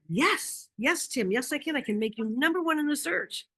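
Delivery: eager yes, pitched high; eager agreement to a struggling contractor, excited. Retold or imitated. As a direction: gush with relief. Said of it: "I was so excited".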